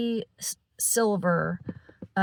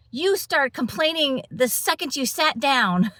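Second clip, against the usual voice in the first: higher, sharper mock of an annoyed stranger; imitating a snappy woman scolding her; retold angry lady; 6 semitones higher than usual. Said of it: "angry lady".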